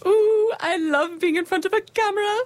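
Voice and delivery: thrilled to be filmed; excited. Acted. Boss loves the camera.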